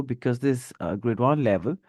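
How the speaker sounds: normal talking voice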